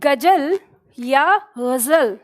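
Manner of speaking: गजल first with plain ज, then with Urdu z, slowly; गजल then ग़ज़ल, contrasting the sounds; two pronunciations. Shown as a demonstration. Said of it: "पहला या दूसरा". (गजल और ग़ज़ल का अंतर)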